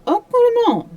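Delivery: high, loud French exclamation; a pleased Belgian detective in a French accent; Poirot, approving. A narrator's reading. Poirot voiced in the story.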